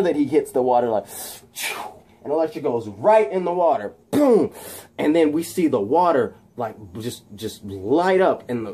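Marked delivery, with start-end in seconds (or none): 4.1-4.5 high, loud punched-out "boom"; comic-book kick sound effect; impact boom. Acted. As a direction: explode on a single high "boom". Voicing a splash into water.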